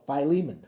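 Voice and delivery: "Philemon" as fy-LEE-mun, stressed in the middle; corrected fy-LEE-mun. Shown as a demonstration. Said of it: "This is how you say it".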